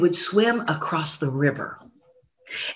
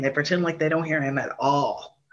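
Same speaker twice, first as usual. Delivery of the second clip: ordinary delivery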